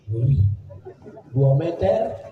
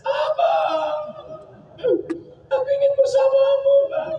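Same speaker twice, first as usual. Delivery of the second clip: high, sobbing wail calling her husband; mock wailing widow. Widow at her husband's grave, hammed-up crying wail. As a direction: ham up a weeping wife clinging to the dead. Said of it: "istri nangis".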